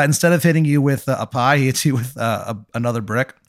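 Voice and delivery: ordinary delivery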